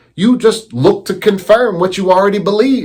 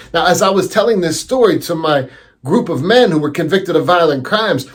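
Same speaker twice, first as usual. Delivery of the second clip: ordinary talk, nothing marked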